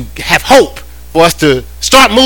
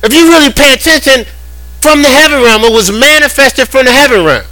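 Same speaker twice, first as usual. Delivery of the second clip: full-force preaching; 8 semitones higher than usual. Acted. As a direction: preach it up high to the congregation.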